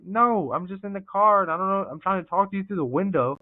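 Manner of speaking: high-pitched retold pleading of innocence; exasperated protest. Detained salesman talks to cop through the window, exasperated protest. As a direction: protest that you mean no offence, pitch up.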